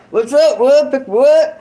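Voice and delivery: calling out high and loud to the wood brothers; mock loud greeting. Ex-con calls out to his wood brothers, mock loud greeting.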